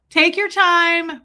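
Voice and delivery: high, loud, stretched-out words; mock leisurely. Streamer mimicking their unhurried self on stream, mock leisurely. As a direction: draw it out slowly in a playful put-on voice. Imitates own dawdling on streams.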